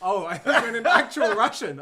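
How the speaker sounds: talking through laughter; laughing. Real, not acted. Laughing over Russian joke.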